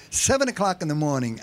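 ordinary talk, nothing marked

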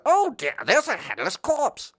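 high, slow, unbothered British voice; British understatement. Retold or imitated. British detective finds a headless corpse, prim high British calm. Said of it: "I love British mysteries".

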